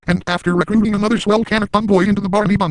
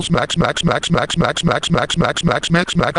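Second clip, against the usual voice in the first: mouthed smacking noise; slower than usual. Acted. Imitated slapping noise.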